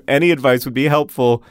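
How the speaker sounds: ordinary talk, nothing marked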